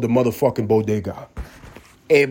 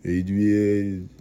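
slurred, lower Rocky call; Stallone impression; 3 semitones lower than usual. Comic imitates Rocky calling for Adrian, slurred and low. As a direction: moan the name like Rocky. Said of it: "That's how it sound".

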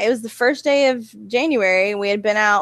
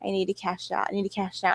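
quiet inner voice; 8 dB quieter than usual. Retold or imitated. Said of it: "I was like".

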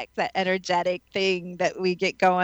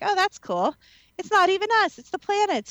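breezy mock calm; 11 semitones higher than usual. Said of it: "you can be like".

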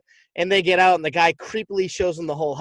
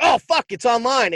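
excited; 6 semitones higher, 4 dB louder than usual. Retold or imitated. Excited discovery quoted.

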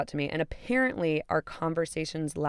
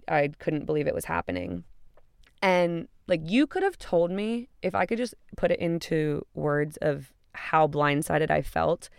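normal talking voice

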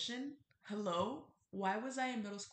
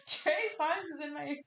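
higher, louder, through laughter; laughing; 8 semitones higher, 10 dB louder than usual. Real, not acted. Laughing as she admits [name] to listeners. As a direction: giggle out the confession. Laughs at her own pick.

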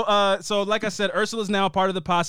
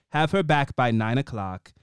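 low, measured warning; stern grandmother; 6 semitones lower than usual. Retold or imitated. Imitating Grams warning a disliked boy, stern and low. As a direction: lay down the curfew, deep and firm. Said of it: "if it was Dawson, she'd be like".

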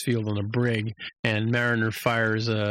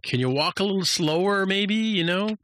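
nagging captain; 9 semitones higher than usual. Retold or imitated.

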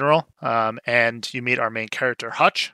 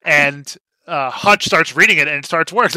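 normal talking voice

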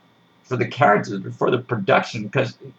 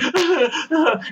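mock frightened laugh. Imitating scared actress.

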